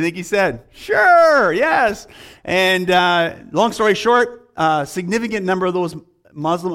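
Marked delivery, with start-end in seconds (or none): 0.7-2.1 high, loud delighted agreement; eager yes. Retold or imitated. Missionary agreeing to teach the imams, high loud and delighted. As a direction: burst out with an obvious yes.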